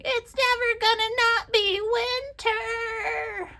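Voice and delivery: high, drawn-out mock whine; whining tantrum. Crafter re-enacting her fit about endless winter, whining tantrum. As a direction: wail it like a child mid-tantrum, hammed-up. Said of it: "whining and having a temper tantrum and going".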